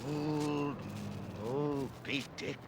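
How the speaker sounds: slow, sinking to a soft hush; brooding fade. Acted. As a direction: muse darkly to himself. Ahab's brooding whisper.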